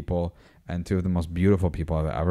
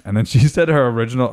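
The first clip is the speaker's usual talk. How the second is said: normal talking voice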